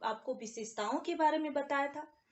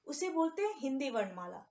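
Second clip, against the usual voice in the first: ordinary talk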